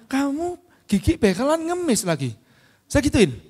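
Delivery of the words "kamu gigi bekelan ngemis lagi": blunt rude jab. Retold or imitated. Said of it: "saya orangnya kasar".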